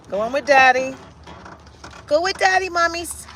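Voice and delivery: sing-song pet coaxing. Real, not acted. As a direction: coax the puppy to daddy. Cooing to the dog.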